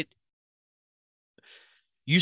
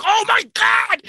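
high, loud starstruck squeal; fan freakout; 7 dB louder than usual. Retold or imitated. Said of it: "I freak out over that".

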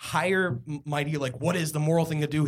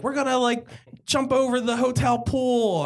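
hyped-up friends; 12 semitones higher than usual. Retold or imitated. Friends' hyped plan quoted.

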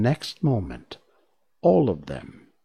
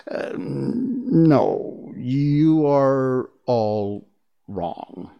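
hesitant, very slow, sinking away; meek; 4 semitones higher, 3 dB louder, much slower than usual. A narrator's reading. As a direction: timidly correct them, stretching the words. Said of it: "said the little man meekly".

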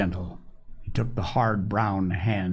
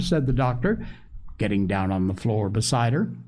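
usual speaking voice

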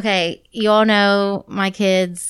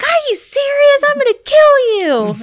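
very high, loud mock outrage; hammed-up high-pitched indignation; upset; 6 dB louder than usual. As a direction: imitate a furious kid shrieking. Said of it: "she was so upset".

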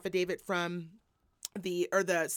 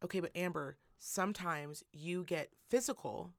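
softer, calmly pleading husband; imitating calm; 3 semitones lower, 7 dB quieter than usual. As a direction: quietly talk her down. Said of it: "you can hear him very calmly".

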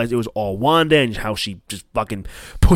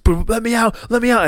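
higher, muffled cry to be freed; trapped pleading; 9 semitones higher than usual. Retold or imitated. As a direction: beg from inside the coffin. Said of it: "and it's".

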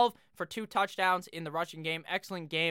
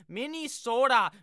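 hammed-up, louder Minnesota accent; 5 semitones higher, 3 dB louder, slower than usual. Football host mosies on down to the Vikings game, bad Minnesota accent. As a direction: put on a dubious Minnesota accent. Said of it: "that was a good Minnesota accent".

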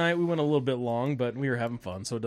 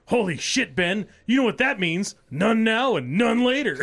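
grandpa imitation; 7 semitones higher, 5 dB louder than usual. Grandpa's quote retold.

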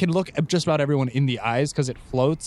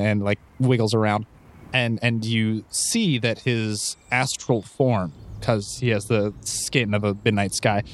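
story narration; 4 semitones lower than usual. A narrator's reading. GM narrates the transformation.

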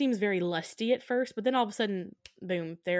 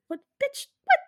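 much higher, a shocked squeak; mock outraged what; 5 dB louder than usual. Real, not acted. Watching the movie, mock outraged squeak. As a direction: react like you can't believe it. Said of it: "sudden outburst at the screen".

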